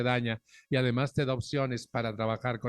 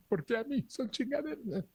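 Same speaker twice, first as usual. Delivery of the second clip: much higher, whining mockery of the stubborn; mock whiny complaint; 10 semitones higher, 5 dB quieter than usual. Mocking people who won't accept reality, much higher whiny voice. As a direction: whine it like someone who refuses reality.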